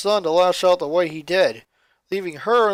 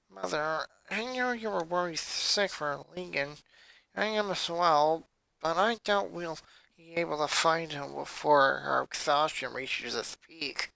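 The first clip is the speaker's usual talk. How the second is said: sleepy and soft; 8 dB quieter than usual. A narrator's reading. Exhausted child speaks to mother.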